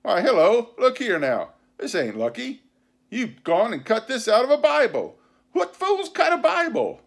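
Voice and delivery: high, loud pirate scolding; indignant pirate leader. A narrator's reading. Pirate captain scolding his men over a cut Bible, indignant. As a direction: exclaim in disbelief, pitch leaping up.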